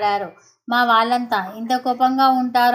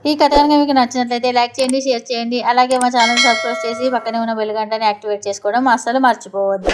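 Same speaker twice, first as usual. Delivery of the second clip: fast, louder subscribe plea; channel outro; 5 dB louder, faster than usual. Acted. Storyteller urging listeners to like and subscribe, fast and loud. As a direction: rattle off the like-and-subscribe request.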